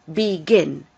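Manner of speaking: "begin" corrected to be-GIN, GIN falling in pitch; stress on last syllable. Shown as a demonstration. Teacher repeating the correct form, "begin" stressed on GIN. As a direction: punch the second syllable of "begin". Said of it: "the stress is on the last".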